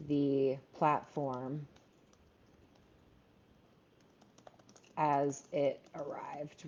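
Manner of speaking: slow, soft, spelled-out model sentence; slow dictation. Shown as a demonstration. Writing teacher dictating a model sentence to the class, slow and soft. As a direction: read it out slowly while typing it into the chat. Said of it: "we would say".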